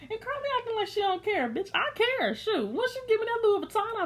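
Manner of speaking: playful high voice as Carly caring about the bag; mock Carly inner voice. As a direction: squeak it out like a greedy inner thought. Voicing Carly's hidden thoughts.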